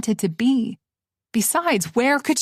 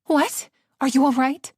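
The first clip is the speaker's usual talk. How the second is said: alarmed; 5 semitones higher than usual. Acted. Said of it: "she demanded".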